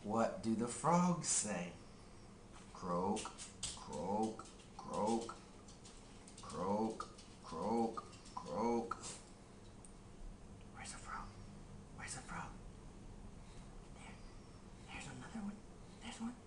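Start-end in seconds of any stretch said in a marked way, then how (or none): 2.7-9.0 low, soft croaking; frog croak. Pretend. Frog sound. 10.7-16.3 unvoiced whisper. Real, not acted. Whispered search.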